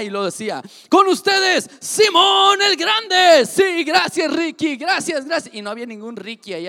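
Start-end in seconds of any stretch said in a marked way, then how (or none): 0.9-3.4 booming, slow stage announcement, louder and higher; drawn-out hype-man introduction of Simón el Grande; announcer shout. Pretend. 3.4-5.5 loud, high thank-yous to the crowd; milk the cheers, thanking your assistant; showman thanks. Pretend. Thanking imaginary applause.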